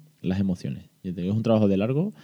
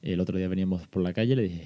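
ordinary talk, nothing marked

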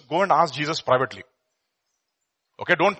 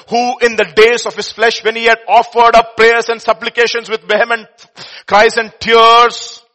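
loud, slow, higher proclamation; proclaiming scripture; 6 semitones higher, 9 dB louder, slower than usual. Real, not acted. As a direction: declare it slowly.

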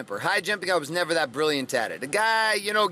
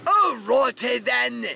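pirate voice; 7 semitones higher, much slower than usual. Acted. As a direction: bellow it slowly like a storybook pirate. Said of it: "like a pirate".

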